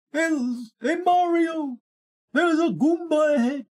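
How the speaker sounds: high, excited Luigi-style shout; high Luigi call. Pretend.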